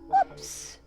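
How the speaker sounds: usual speaking voice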